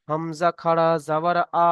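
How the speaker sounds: slow spelling-out, with the final "আ" held long; drawn-out vowel. Shown as a demonstration. Teacher shows students the one-alif stretch, final "আ" drawn out. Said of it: "এক আলিফ পরিমাণ টান দিয়ে পড়তে হবে".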